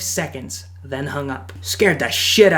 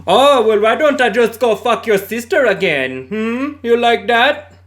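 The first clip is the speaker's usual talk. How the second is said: high, loud mocking taunt; hammed-up smug scammer voice; 8 semitones higher, 6 dB louder than usual. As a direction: needle him like a smug scam caller. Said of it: "I hear the guy".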